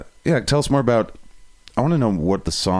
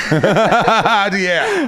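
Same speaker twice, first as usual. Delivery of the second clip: loud high screamed yeah; 11 semitones higher, 5 dB louder than usual. Shown as a demonstration. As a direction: scream it out long. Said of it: "great screamer".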